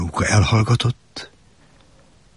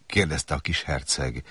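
usual speaking voice

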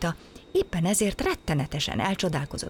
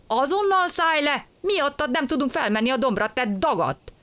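angry scolding; 9 semitones higher than usual. Acted. Engine driver scolds stowaway.

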